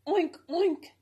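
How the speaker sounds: a loud, high piglet oink; squealing oink. A narrator's reading. Piglet teasing the hedgehog, loud squealing oink. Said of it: "the piglet squealed".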